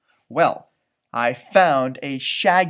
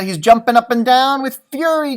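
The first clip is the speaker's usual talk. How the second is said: plain reading voice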